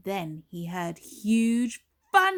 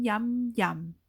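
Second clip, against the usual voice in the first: usual speaking voice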